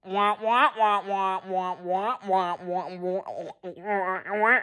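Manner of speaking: muted trombone wah-wah like Peanuts adults. Retold or imitated. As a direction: Peanuts grown-up gibberish, trailing off. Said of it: "She goes".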